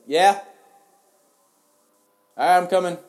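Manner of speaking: loud, high yell to another room; real shouted reply from afar; calling out. Real, not acted. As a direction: holler back to parents who called you. Calling back to parents off mic.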